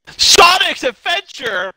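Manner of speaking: high, slow, loud announcing; excited shout. Real, not acted. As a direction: belt it out like a title. Excited about new game mode.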